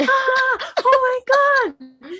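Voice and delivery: high shrieking oh my God, laughing; drawn-out high screech with laughter; mock scream. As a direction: imitate a guy screaming in fright, high. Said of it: "He's like".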